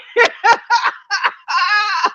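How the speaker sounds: high bursting laugh at the chat; burst of laughter. Real, not acted. Streamer to chat, laughing hard at the trolling.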